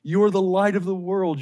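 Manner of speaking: ordinary delivery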